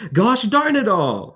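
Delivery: high, slow, loud exclaiming; a tree crying out loud in surprise; loud exclamation. Acted. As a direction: belt it out like a startled tree. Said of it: "exclaims loudly in response".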